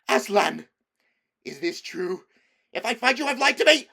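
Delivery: high, loud enraged witch; furious. Acted. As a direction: threaten him in a fury. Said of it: "cried the queen".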